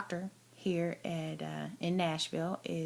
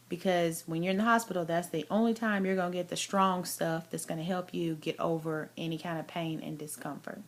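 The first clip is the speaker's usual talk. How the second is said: ordinary delivery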